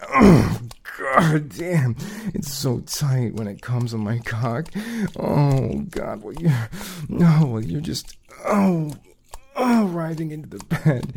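mounting ecstatic cries. Acted. Climax, cries.

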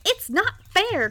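put-on high, drawn-out boyish whine; childish cry of protest. A narrator's reading. Young duke out of arrows, crying in protest. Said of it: "cried the Duke".

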